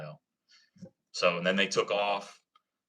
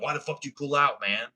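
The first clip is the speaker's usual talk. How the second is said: higher mock-angry retold yell at the driver; 6 semitones higher than usual. Biker yells at the truck driver who pulled out, retold angry. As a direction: imitate the biker chewing out the driver. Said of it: "He was yelling at the dude".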